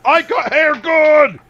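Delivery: high, loud and slow; triumphant shout. Pretend.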